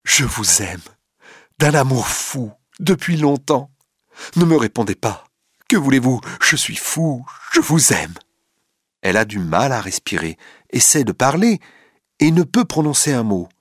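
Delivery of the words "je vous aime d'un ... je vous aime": passionate. A narrator's reading. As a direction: pour out his love, pitch climbing. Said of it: "répète d'une voix passionnée".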